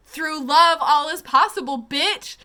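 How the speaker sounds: loud, high, gloating proclamation; triumphant declaration. Retold or imitated. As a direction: throw it out like a mic drop. Cheering the Prime's line.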